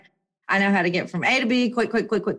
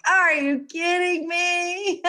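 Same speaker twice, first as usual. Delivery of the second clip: high, slow drawn-out disbelief; exasperated disbelief; 9 semitones higher, much slower than usual. Retold or imitated. No neighbors home, high slow exasperation. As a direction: stretch out the disbelief. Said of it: "So I'm like".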